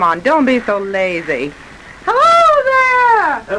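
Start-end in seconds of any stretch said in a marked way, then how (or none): 2.1-3.6 long, high call across the water. Acted. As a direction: hail a far-off boat, stretching the hello. Calling out across the dark lake.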